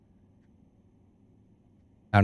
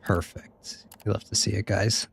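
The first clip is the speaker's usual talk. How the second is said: whispered perfect; 7 dB quieter than usual. Real, not acted. Quiet aside after saving.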